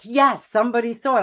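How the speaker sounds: like finally being proven right; triumphant relief. Retold or imitated.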